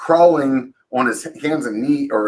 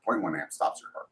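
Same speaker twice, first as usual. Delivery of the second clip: quiet repeated mantra; 4 semitones lower, 12 dB quieter than usual. Retold or imitated. Repeating a thought to himself.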